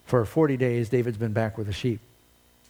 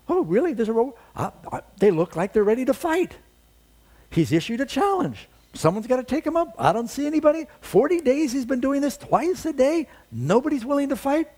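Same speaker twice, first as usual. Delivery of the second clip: much higher and louder, rousing indignation; indignant challenge; 10 semitones higher, 4 dB louder than usual. Acted. David to the gathering soldiers, high and indignant. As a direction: stir them up, how can nobody fight.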